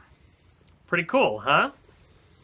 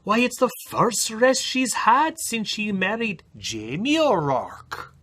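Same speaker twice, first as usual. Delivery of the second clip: higher, gossipy Irish village women; gossiping village women; 7 semitones higher than usual. Acted. Village women gossiping about Aileen, high voice. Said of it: "the village women said".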